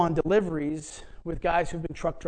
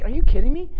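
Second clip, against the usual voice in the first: incredulous retort; 6 semitones higher, 9 dB louder, faster than usual. Retold or imitated. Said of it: "he's like".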